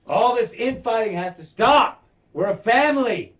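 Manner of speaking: loud, high-pitched commanding shout; king's outburst. Retold or imitated. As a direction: shout down a squabbling family. Acts the king's outburst at family.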